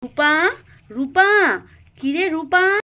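put-on loud mother's call for her child. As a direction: call the name out across the yard. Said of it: "বাইরে চলে যায় রূপাকে ডাকতে".

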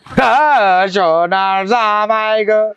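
slow, drawn-out fake lament; hammed-up keening, words stretched long; mock wailing. Shown as a demonstration. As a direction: demonstrate how to wail for a spouse. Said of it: "এইভাবে কান".

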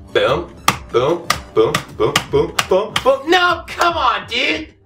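normal talking voice

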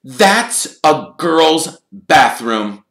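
loud, high, slow disbelief; shocked gasp. A narrator's reading. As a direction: stretch each word out in shock. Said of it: "he gasped".

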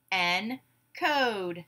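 "Encode" slowly split in two; syllable by syllable. Shown as a demonstration. Class breaks the word apart together, syllable by syllable. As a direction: say "Encode" as en, pause, code. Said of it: "break it apart into syllables".

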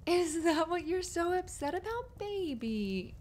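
put-on high, loud baby-talk coo; cooing sympathy. Cooing at upset partner.

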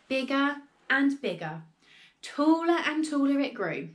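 ordinary delivery, nothing marked

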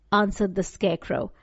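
ordinary delivery, nothing marked

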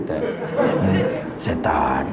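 setan bersuara sangat rendah dan lambat; suara setan. Pretend. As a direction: garau menggoda seperti setan. (suara setan mengejek, rendah dan meleret)

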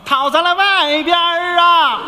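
hawker's cry. Shown as a demonstration. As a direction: cry it out slowly. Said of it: "吆喝出来呀".

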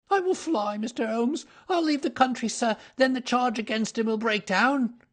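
high, desperate offer; frightened culprit bargaining for escape; frantic pleading. Acted. Culprit begging detective to let him go.

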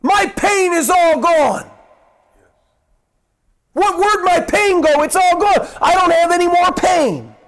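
high, loud astonished cry of relief. Retold or imitated. Healed man marvels to the preacher, loud and astonished. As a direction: cry out in wonder that the pain is gone. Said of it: "he cries out and he goes".

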